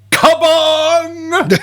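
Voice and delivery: high, loud drawn-out holler; big hammed-up war cry; cartoon battle yell. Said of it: "waiting for him to yell".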